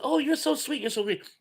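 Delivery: high, softening coo of thanks; high-pitched, acted-out fondness; imitated gushing delight. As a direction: coo it like a touched grandmother. Voices grateful grandmother in retold story.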